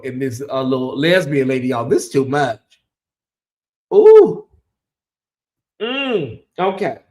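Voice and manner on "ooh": loud, high-pitched shocked ooh. Real, not acted. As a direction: yelp a startled ooh. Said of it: "reaction exclamation".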